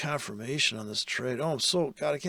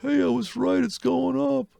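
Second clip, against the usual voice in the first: mock giddy newbie, much higher and louder; excited beginner; 10 semitones higher, 6 dB louder than usual. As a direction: play the naive trader thrilled at his fill. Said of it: "a beginner can only think about".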